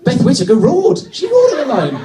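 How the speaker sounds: high, loud comic exclamation; mock-incredulous outburst. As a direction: shout it in exaggerated disbelief. Mock outrage at over-excited tourist.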